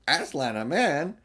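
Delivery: stern, incredulous retort; sternly offended beaver. A narrator's reading. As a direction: rebuke her for the very idea. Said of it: "said Mister Beaver sternly".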